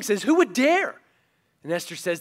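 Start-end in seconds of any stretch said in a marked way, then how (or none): 0.2-1.0 higher, louder outraged demand; outraged king. Retold or imitated. King reacting to Esther's news, loud and outraged. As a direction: bark the question in disbelief.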